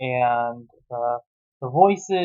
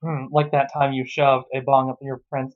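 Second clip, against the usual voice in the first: ordinary talk, nothing marked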